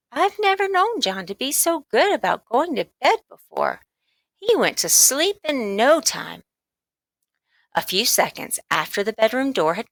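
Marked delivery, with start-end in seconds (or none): 0.1-6.4 high motherly voice; a pleased mother marvelling; mother's voice. Acted. Mother telling father John slept fast, high and pleased. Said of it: "she said".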